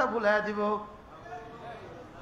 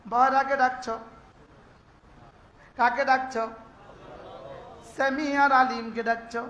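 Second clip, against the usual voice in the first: ordinary talk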